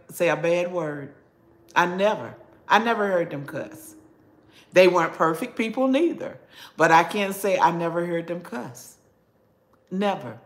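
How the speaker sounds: ordinary talk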